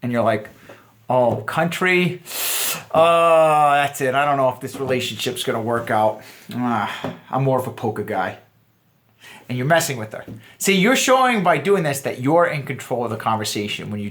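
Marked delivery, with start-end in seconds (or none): 1.1-8.4 mock-disappointed, smirking tease; cocky teasing. Shown as a demonstration. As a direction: playfully fake a dealbreaker. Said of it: "cocky smirk".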